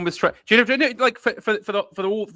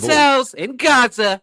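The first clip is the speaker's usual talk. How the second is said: higher, louder, slow Trump drawl; imitating Trump; Trump impression; 8 semitones higher, 8 dB louder, slower than usual. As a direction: stretch it like a boast. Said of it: "do the best Trump voice".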